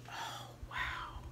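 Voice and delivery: breathless hushed wow; awed whisper. Real, not acted. Trying on moon earrings, whispering in awe.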